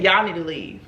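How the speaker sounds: curt, flat-out order to leave; just like that, no softening; blunt dismissal. Retold or imitated. Friend telling the girls to leave, curt and blunt. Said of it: "Just like that".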